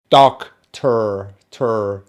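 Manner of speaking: American "doctor" with a sounded final R; American pronunciation. Shown as a demonstration. Teacher contrasting rhotic accents, American R demo. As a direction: say "ter" with an American R. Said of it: "an American would probably say".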